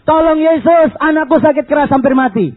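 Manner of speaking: loud, high desperate plea; a mother's urgent cry for help; crying out to Jesus. Retold or imitated. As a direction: shout it high, calling for help. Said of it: "teriak sama Yesus".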